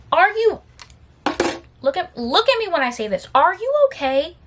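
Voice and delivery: high, halting disbelieving question; appalled, pointed questioning; incredulous challenge. Acted. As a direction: confront him, pitch rising in disbelief. Confronting the absent coworker.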